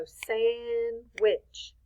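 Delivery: SANDWICH drawn out slowly in two beats; slow clap-along SANDWICH; syllable by syllable. Shown as a demonstration. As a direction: split SANDWICH into SAND, pause, WICH. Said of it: "I'm gonna snap since I'm holding the board".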